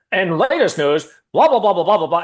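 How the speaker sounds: loud, high newsreader patter; mock a TV news intro; news anchor voice. Friend mocking the news, in a loud anchor voice. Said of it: "as soon as you hear that voice".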